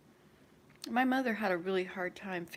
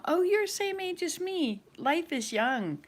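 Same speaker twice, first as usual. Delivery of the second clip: bright excited quote; 9 semitones higher, 5 dB louder than usual. Retold or imitated. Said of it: "I said it to my daughter-in-law".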